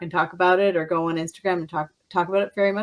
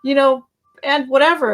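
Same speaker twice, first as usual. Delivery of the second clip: defiant retort; 7 semitones higher, 8 dB louder than usual. Retold or imitated. Retold defiant reply.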